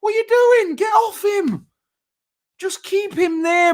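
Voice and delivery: high pitched disbelieving shout; exasperated yell. Real, not acted. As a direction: scold the player on screen. Yelling at the player on screen.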